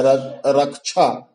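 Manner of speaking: रक्षा said slowly as "rak-chha"; common mispronunciation. Shown as a demonstration. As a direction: put chh in place of sh in रक्षा. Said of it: "कछा बोला जाता है".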